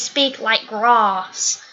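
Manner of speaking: "grass" stretched slowly into "grahss"; long-A British grass. Shown as a demonstration. Telling viewers Brits don't always speak so, drawn-out "grahss". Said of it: "us British folk do not always".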